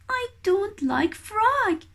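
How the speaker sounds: high little chick voice, pouting; fussy chick. Acted. Baby kiwi refusing weta, high and pouty. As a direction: pout it out like a picky baby bird. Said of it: "says Hooey".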